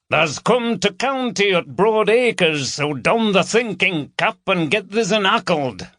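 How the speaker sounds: joking broad Yorkshire patter; broad Yorkshire dialect. A narrator's reading. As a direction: ham up the Yorkshire to baffle the southerner. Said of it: "Just a bit of Yorkshire dialect".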